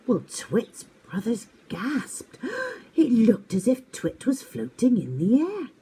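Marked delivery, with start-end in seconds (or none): 2.4-2.9 gasp. Acted. Brothers gasp.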